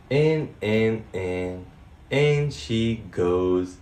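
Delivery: low, slow, soothing. Acted. Tucking chicken in nest.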